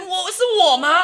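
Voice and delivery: high, loud, confused husband; flustered husband. Retold or imitated. Husband to the doctor in the delivery room, high and flustered. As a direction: play the panicked husband. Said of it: "因为我老公也慌了".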